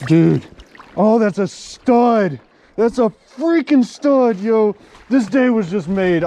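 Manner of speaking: loud, high, jubilant hollering; hyped up. Real, not acted. Angler celebrating a stud after a bad day, hyped. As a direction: crow over it with stretched, punched words. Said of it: "I'm sorry I'm so hype".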